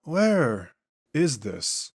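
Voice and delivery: cold alien entity, slow and strange; an ancient being waking in a body; eerie otherworldly questioning; changed, possessed voice. Acted. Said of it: "Vaughn's voice and inflections are noticeably different".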